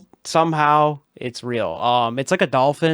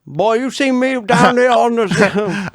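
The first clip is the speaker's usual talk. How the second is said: slow, high hammed-up Southern drawl; Southern accent; 9 semitones higher, 5 dB louder than usual. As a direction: ham up a thick Southern accent. Mock Southern voice.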